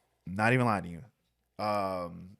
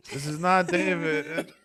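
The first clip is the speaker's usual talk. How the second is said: slow, raised pretend voice; fake other-person voice; 5 semitones higher, 4 dB louder, slower than usual. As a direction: denies being himself, slowly. Pretending he isn't himself.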